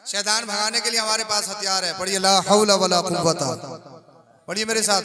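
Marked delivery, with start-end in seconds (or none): 2.2-3.5 "ला हौला वला कुव्वता" recited in full with every vowel sounded; proper recitation. Shown as a demonstration. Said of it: "सही करके अदायगी होने चाहिए". ("ला हौला वला कुव्वता" की पूरी, सही अदायगी)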